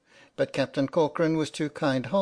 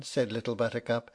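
ordinary delivery

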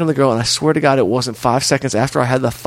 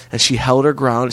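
normal talking voice

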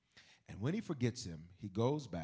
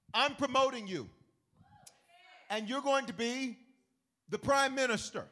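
higher, louder royal decree; Pharaoh proclaiming; 14 semitones higher, 11 dB louder than usual. Retold or imitated. Pharaoh promoting Joseph, higher and loud. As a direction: decree it like a king. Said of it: "And he says".

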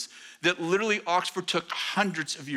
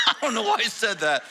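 laughing; 4 semitones higher than usual. Real, not acted. Laughing at Simon's comment.

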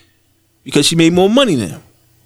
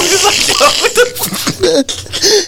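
laughing; 14 semitones higher, much slower than usual. Real, not acted. Laughing rant about commercials.

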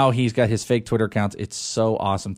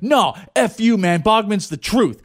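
higher, mock-indignant fan comeback; internet troll; 6 semitones higher than usual. Host acting out a troll defending Bogman, mock indignant. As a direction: fire back as an over-defensive superfan troll. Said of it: "you could just troll anybody".

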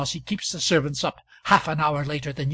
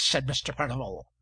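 plain reading voice

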